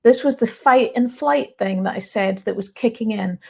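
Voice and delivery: ordinary delivery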